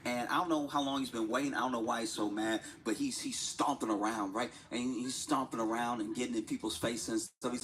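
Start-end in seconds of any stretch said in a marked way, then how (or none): none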